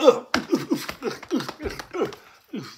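high-pitched grunts of a scuffle; repeated short struggle noises; imitated grunts. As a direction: mimic the grunts of a rough arrest. Imitates being grabbed and roughed up.

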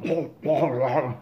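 choking gurgle in the throat; mock gurgling. Patient has whiskey poured down his throat, mock gurgling. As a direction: imitate a man gagging on poured liquid.

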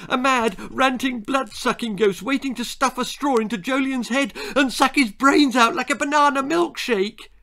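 put-on high, loud, gleeful child's rant; excited squeal. A narrator's reading. Child piling gory guesses on grandma, excited squeal. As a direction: squeal on, building to the end. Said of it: "I squealed".

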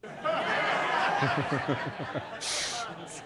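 a long soft real laugh at his own joke; laughter. Real, not acted. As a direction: laugh quietly at the punchline.

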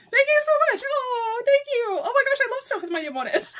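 high, gushing squeal; squealing with gratitude; overjoyed thanks. Real, not acted. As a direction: gush thanks in a high, delighted voice. Thanking sender, overjoyed by gift.

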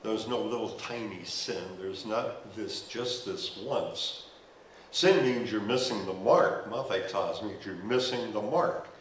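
usual speaking voice

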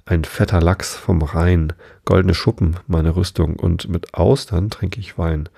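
plain reading voice

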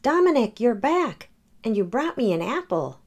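high, bright happy greeting; delighted new owner. Acted. Someone else cheering the moose's return, high. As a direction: welcome the pet home with joy.

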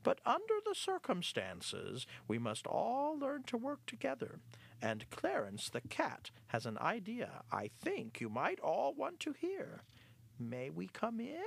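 high-pitched, sheepish little rat; a rat sheepishly asking the mice for trust. Acted. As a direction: apologize in a high squeaky voice. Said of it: "he began sheepishly".

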